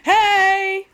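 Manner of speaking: loud, high mock yell; hammed-up shout from the video. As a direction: bellow it like the clip.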